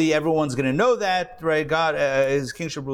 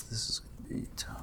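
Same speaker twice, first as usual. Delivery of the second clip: muttered under his breath; whispering; 19 dB quieter than usual. Real, not acted. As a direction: whisper it to yourself. Muttering to himself while setting up.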